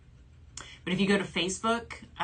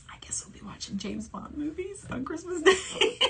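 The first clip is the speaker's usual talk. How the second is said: breathy giggling whisper, pitched up; whispered laugh; 11 semitones higher than usual. Real, not acted. Admitting to viewers the Christmas plan, whispering through laughter. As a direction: laugh the line out under your breath.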